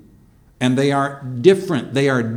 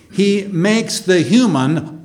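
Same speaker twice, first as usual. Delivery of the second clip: ordinary talk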